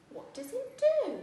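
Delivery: high, bright rising question; excited question. Acted. Teacher asking the class about the kettle, high excited question. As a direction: ask it like a big exciting mystery.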